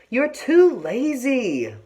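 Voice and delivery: drawn-out scolding big sister; sisterly scolding, stretched out. A narrator's reading. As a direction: scold her lazy little sister, slow and high. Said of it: "the older sister scolded the younger".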